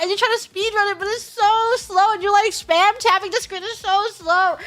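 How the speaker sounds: high-pitched exasperated complaint; exasperated whine. Real, not acted. Complaining about repeating slow ride.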